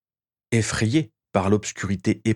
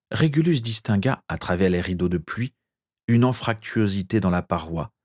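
ordinary delivery

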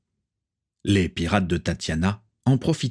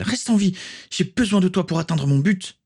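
higher, pressing and desperate; urgent plea; 11 semitones higher than usual. Acted. Tatiana shaking the dying Swazik, urgent. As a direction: beg her to stay alive.

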